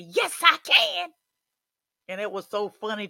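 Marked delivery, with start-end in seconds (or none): 0.0-1.1 put-on much higher, louder, slow child's yell; a small boy belting it out; little boy's shout. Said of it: "the little young boy, he said".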